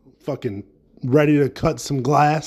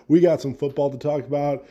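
ordinary delivery

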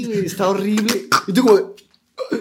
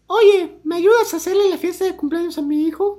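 mom's request in a much higher put-on voice; imitating the mom; 13 semitones higher than usual. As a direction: jump way up in pitch to play the rich kid's mom. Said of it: "le dice así de". (imitando a la mamá con voz mucho más aguda)

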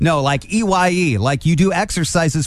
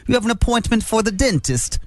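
put-on British accent; 8 semitones higher than usual. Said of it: "my Siri has a British accent".

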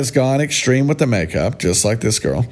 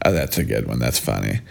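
normal talking voice

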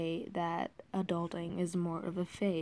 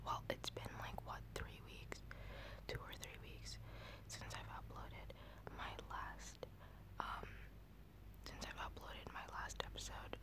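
whisper; 3 semitones higher than usual. Real, not acted. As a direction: whisper close to the new mic.

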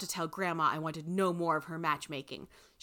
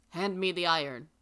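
ordinary delivery, nothing marked

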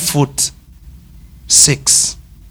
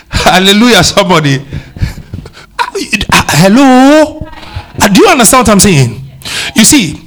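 rousing call; 6 semitones higher than usual. Real, not acted. Preacher rousing congregation.

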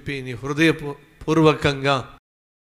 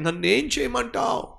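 pleading cry; 9 semitones higher than usual. Retold or imitated. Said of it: "అని చెప్పి దేవుని దగ్గరకు వచ్చాడండి".

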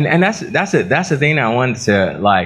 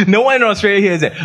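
higher, faster, laughing as she repeats it; laughing protest; 11 semitones higher, faster than usual. Real, not acted. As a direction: toss it off laughing, pitch up.

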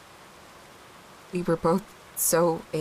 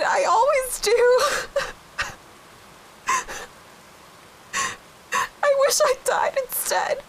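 much higher, breaking into sobs and crying laughter; tearful apology as David; 9 semitones higher than usual. Acted. Grieving [name] voices her dead husband David, sobbing an apology. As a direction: say sorry through tears, voice cracking higher and higher. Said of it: "What do you think David would say".

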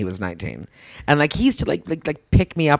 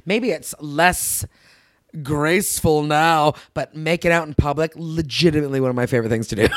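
ordinary talk, nothing marked

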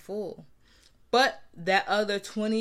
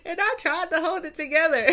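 high, strained voice, close to tears; high voice to daughter; 13 semitones higher, 5 dB louder than usual. Retold or imitated. Answering the daughter from the driver's seat in a high, teary voice. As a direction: voice pitched way up while telling her about the hard day. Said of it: "And I'm like".

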